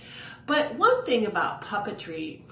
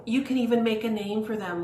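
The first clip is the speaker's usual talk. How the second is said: usual speaking voice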